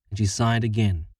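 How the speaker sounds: ordinary delivery, nothing marked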